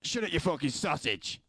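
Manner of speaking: snide put-on character voice; Mister Willie voice. Shown as a demonstration. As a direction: sneer it like the rude stream persona. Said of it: "That's how Mister Willie fucking speaks".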